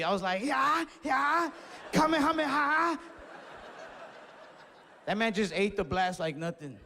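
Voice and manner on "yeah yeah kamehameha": high, loud Kamehameha yell; anime attack shout. Retold or imitated. Mock anime hero hurling a blast in the fight, high and loud. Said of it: "stuff I see on T V".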